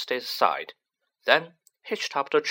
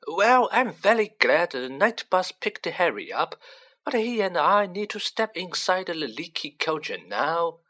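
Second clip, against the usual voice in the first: higher, brisk, mildly irritated official; testy; 7 semitones higher than usual. A narrator's reading. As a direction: hurry things along, a touch annoyed. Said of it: "said Fudge testily".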